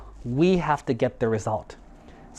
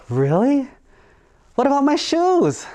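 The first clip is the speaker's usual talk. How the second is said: high, louder worried voice; anxious bear; 11 semitones higher, 5 dB louder than usual. Acted. Bear asks the crow about his shoes, worried.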